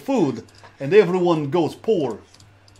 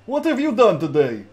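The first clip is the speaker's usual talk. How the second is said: ordinary talk, nothing marked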